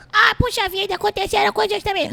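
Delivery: tiny cartoon buddy voice; very high cartoon voice. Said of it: "comecei a fazer essa voz assim".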